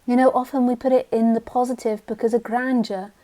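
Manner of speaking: usual speaking voice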